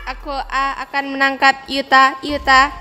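low menacing robot voice; slow deep machine threat; toy robot. Acted. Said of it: "Aku adalah robot Sakura". (suara robot mainan yang rendah)

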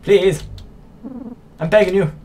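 high, loud acted begging; hammed-up desperate plea; pleading. Voices a character pleading for mercy.

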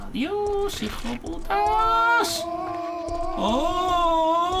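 very high, stretched howl; high drawn-out wail. Real, not acted. Player cursing enemies in the game, high drawn-out wail. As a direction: wail it high, holding the vowels long.

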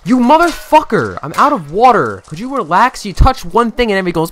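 loud, high, fast angry outburst; furious yelling. Real, not acted. Gamer yelling at his clumsy janitor, furious. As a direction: yell at the game in real exasperation.

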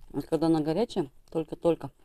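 chewing while talking; eating with fingers; mouth full. Real, not acted. Seen 1: bites green onion, then puts fish in mouth and chews.

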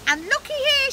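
very high excited witch; witch crying out. A narrator's reading. Witch pointing out the time, crying out. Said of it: "she cried".

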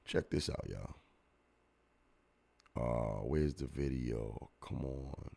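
whispered check this out; hushed, voiceless call to look; a soft whisper telling viewers to check this out; unvoiced aside. Real, not acted. Showing a clip to viewers.

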